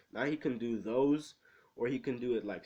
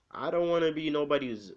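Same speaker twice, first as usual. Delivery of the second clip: raised, louder mock protest; retold defiant refusal; 4 semitones higher, 6 dB louder than usual. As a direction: act out a stubborn refusal, pitched up.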